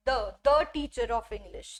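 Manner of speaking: "the" as a short "thuh", slowly; drawn-out "the teacher of English" with a schwa; teacher slowly modelling "the teacher"; slow demo of "the". Shown as a demonstration. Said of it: "इसका pronunciation हो जाएगा".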